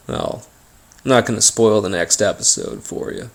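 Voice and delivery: usual speaking voice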